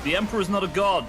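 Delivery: heretic proclamation. Pretend. Suggests heretical line to shout.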